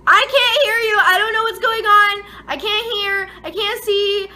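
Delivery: high, loud mock panic; hammed-up alarmed shouting; retold panic. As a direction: cry out as if deafened and blinded. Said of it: "having a panic attack".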